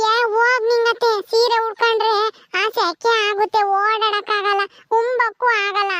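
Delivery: high, slow, drawn-out put-on voice; childlike young aunt. Young aunt explains why she changed her sari, slow childlike whine. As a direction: explain it in a stretched, childlike singsong. Plays Paddu explaining herself.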